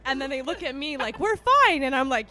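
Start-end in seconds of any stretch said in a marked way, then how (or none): 1.2-1.8 high, loud protest; mock insistent reassurance. Drunk friends telling her they're okay, mock insistent. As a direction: imitate friends loudly insisting.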